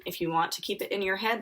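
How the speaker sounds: ordinary delivery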